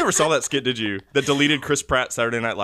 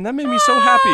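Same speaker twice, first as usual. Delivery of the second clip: much higher and louder, near tears of joy; overjoyed squeal; 8 dB louder than usual. Real, not acted. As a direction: squeal it out, thrilled to bits. Delight at Hugh Grant cameo.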